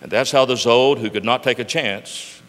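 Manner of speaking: put-on lively, loud read-aloud for children; storybook reading. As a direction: tell it like a bedtime rhyme to grandkids. Reads Dr Seuss story aloud.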